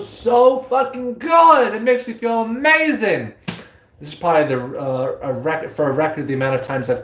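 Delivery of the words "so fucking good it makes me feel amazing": high, drawn-out blissful gush; swooning with delight; rapturous. Real, not acted. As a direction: savor every word like pure pleasure. Ecstatic about metaphysics talk.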